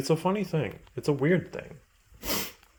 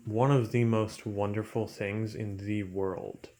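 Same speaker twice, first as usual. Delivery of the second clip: ordinary talk, nothing marked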